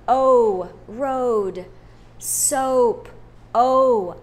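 O called out loud, then road and soap; loud model. Shown as a demonstration. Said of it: "say them in a loud voice".

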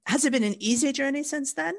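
ordinary talk

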